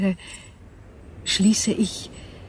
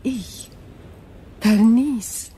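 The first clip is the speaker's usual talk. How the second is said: normal talking voice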